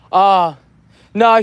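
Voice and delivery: normal talking voice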